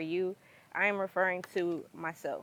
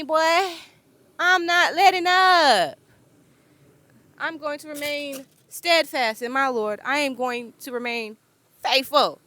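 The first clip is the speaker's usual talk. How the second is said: louder and higher, defiant vow to the devil; defiant preaching; 7 semitones higher, 8 dB louder than usual. Real, not acted. Telling the devil off and vowing to stay faithful to the Lord. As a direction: rebuke the devil with full force.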